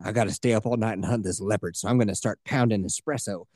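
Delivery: low and cocky, a big cat hunter; tough hunter. Retold or imitated. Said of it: "doing accents".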